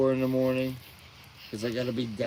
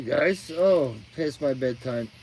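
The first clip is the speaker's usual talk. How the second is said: ordinary delivery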